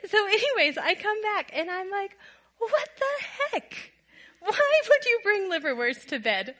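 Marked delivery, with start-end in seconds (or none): 2.6-6.5 incredulous outburst. Retold or imitated. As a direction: let the disgust burst out in mock shock. Disgusted wife confronts husband.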